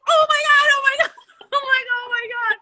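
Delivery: high, loud mock panic cry; panicked oh my God. Host retelling her panic at being late, high mock cry.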